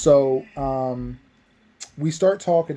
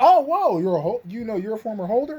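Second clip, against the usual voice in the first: surprised gasp; 7 semitones higher than usual. Retold or imitated. Maze surprised at former holder.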